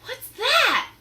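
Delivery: high, loud startled shout. Acted. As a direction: demand what the noise is. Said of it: "he shouted".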